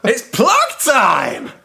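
high, loud, slowed plug time cry; gleeful shout. Acted. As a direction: announce it like a big reveal.

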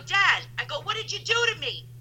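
high-pitched, indignant daughter; hammed-up accusing whine; mock complaint to father. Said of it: "And I told my father".

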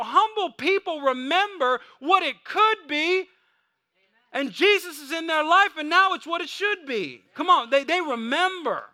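high, loud preacher's cry; full-force preaching. Acted. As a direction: drive it home to the congregation.

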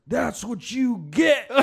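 high, slow, loud taunt; mocking bouncer. Bouncer taunting the fallen runner, slow loud jeer. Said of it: "the guy just looks over and goes".

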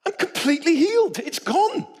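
high, bright-eyed announcement of healing; excited. Retold or imitated. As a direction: tell it as breathless good news. Wife's excited healing report.

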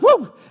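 single high, loud woof; imitated dog bark. Dog nagging the pastor to go out, one high loud woof. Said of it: "she'll just".

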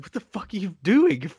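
lightly voiced angry boss; a hint of Tony snapping into the phone; mock Tony. Tony yelling at his crew over a bad line, mild mock anger. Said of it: "he's like".

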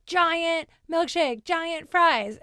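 retold order with hungry relish; eager ordering. Acting out placing her order.

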